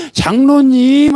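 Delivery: loud, high, drawn-out acted cry; angry outburst. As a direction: burst out to the elder, stretching the word. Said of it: "얼마나 화가 나 있습니까". (화가 터진 듯 높고 길게 외치며)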